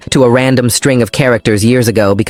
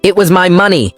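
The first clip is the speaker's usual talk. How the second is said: shouting; 8 semitones higher than usual. A narrator's reading. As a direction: finally blow up at him. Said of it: "I shouted, finally losing my cool".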